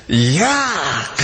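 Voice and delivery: a grossed-out yuck; disgusted yuck. Acted. Customer seeing the disgusting meal, disgusted. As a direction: recoil from the plate. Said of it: "and he said".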